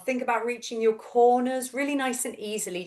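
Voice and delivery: usual speaking voice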